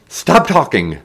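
high, loud, exasperated order; shout. A narrator's reading. Martin tries to read as Dole chatters, shouting. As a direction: shout to silence him. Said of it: "Martin shouted".

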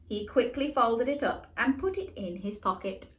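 usual speaking voice

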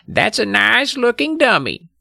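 high, put-on lady's voice; a mild compliment in a high character voice; high-pitched polite neighbour lady; quiet polite neighbour. A narrator's reading. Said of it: "Missus Miller said quietly".

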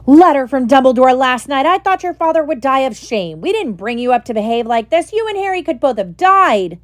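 mother's howler letter bellowing at her son; furious yell. A narrator's reading. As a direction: scream it at the son who stole the car. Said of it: "Missus Weasley's yells".

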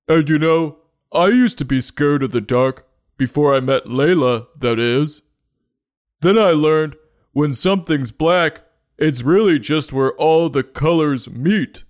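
high cartoon Minotaur voice; put-on, hammed-up storybook character; Melvin. Minotaur turning to the rest of the unicorns, high cartoon voice. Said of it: "Melvin told her".

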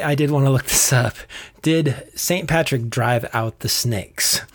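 ordinary talk

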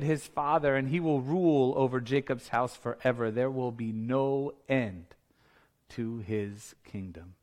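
slowing, fading to a soft low close; hushed awe. Acted. Announcement settles to hushed close.

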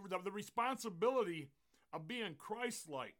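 loud, high, ringing sermon delivery; full-force preaching. Acted. Pastor charges believers to represent Christ, preaching at full force. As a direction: proclaim it to the whole congregation with stretched key words.

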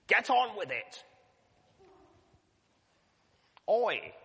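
high, loud impatient bark; mock scolding. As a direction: snap at them like a cross coach. Mock scolding parent or coach.

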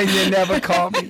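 high, whiny guilt-trip; a nagging mother scolding; nagging mom voice. Pretend. Mock nagging parent.

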